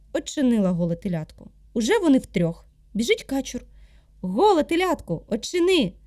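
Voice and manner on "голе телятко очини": high drake calling at the door. Acted. Drake asking the calf to open up, high call. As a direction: pipe up high and insistent.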